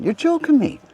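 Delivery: a squeak of mock disbelief; retold disbelief. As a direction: act stunned, voice leaping high. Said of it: "I went".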